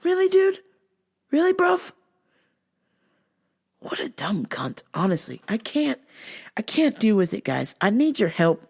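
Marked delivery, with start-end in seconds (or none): 0.0-1.9 high, incredulous questioning; high disbelief. Real, not acted. Mocking the coworker, high incredulous. 3.8-4.8 whispered under the breath; whispered insult. Real, not acted. Insulting coworker, whispered aside.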